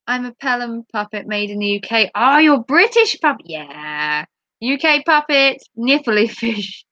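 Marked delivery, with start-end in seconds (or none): none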